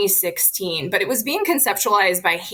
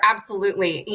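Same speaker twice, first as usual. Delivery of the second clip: ordinary talk, nothing marked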